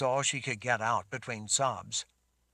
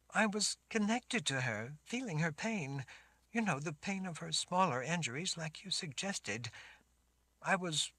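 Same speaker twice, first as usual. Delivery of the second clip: put-on high girl's voice, unsure; a young girl explaining hesitantly; shaken girl; 7 semitones higher than usual. Sammy explains.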